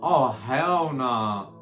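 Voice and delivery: drawn-out hell nah. Real, not acted. Reacting to the Trump quote.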